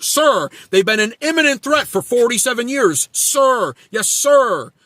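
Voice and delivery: a fawning supporter thanking Trump; mocking loyal follower. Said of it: "T D S is".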